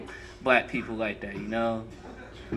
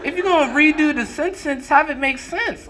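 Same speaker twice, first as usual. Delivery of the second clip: higher, pleading exasperation; exasperated high voice. Retold or imitated. Telling the bad reader off, exasperated and high. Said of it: "I was like".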